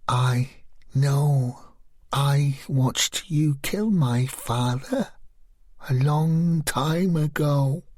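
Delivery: slow, level, eerie calm; chillingly composed; slow, even, eerie tone; evenly, slowly. Acted. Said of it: "she spoke evenly again, slowly".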